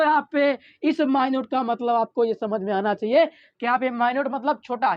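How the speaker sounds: ordinary delivery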